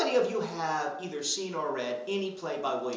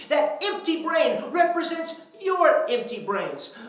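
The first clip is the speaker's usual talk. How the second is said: mock tirade; 11 semitones higher, 7 dB louder, slower than usual.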